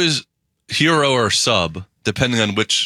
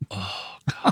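whispered craving; 3 semitones lower, 9 dB quieter than usual. Real, not acted. Craving a meatball parm sub.

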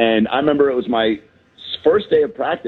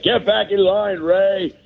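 barking gym coach; 7 semitones higher, slower than usual. Retold or imitated. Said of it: "Ron's like".

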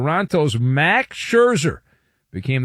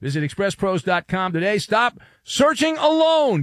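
upbeat sponsor read, pitched up; 6 semitones higher than usual. Acted. Host reading a sponsor ad in a pitched-up ad voice. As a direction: sell it like a radio spot.

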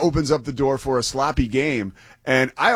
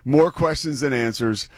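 usual speaking voice

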